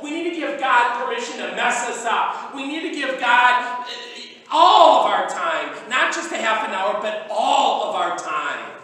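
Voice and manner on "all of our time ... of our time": loud, slow, emphatic preaching; full-force pulpit emphasis. Real, not acted. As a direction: drive home each word of all our time.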